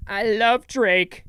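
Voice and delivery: slow, high, loud adoration; mock gushing. Fan won over by the album, mock gushing. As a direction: stretch it out like a converted fan.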